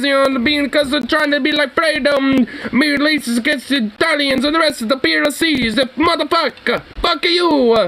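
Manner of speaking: high, hammed-up New York tough guy; mock Sicilian mobster threat; belligerent drunk New Yorker. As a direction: puff up like a mobster ready to swing. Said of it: "belligerent drunk New Yorker".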